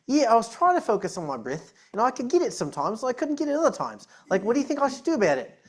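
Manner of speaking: a half-remembered Australian accent, retold. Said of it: "I used to be able to do an Australian accent".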